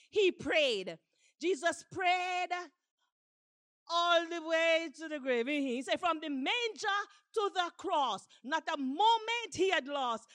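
rousing sermon delivery; impassioned preaching. Acted. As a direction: preach it with fervor, punching the key words.

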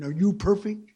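mock pushy repeat of "Are you perfect?"; insistent challenge. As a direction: demand an answer, pushing on "you". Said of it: "I asked you a question".

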